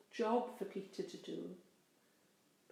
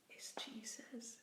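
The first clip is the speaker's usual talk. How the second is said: breathless, soft and slow realisation; hushed awe; 2 semitones higher, 13 dB quieter, slower than usual. A narrator's reading. John realising who stands on the shore, hushed slow awe. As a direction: let it dawn on you, almost whispered. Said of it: "and he shouted".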